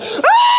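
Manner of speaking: sudden high laughing shriek; mock scream of fright. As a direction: shriek like a terrified friend on a slide. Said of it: "she'll be like".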